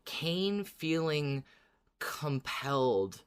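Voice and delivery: usual speaking voice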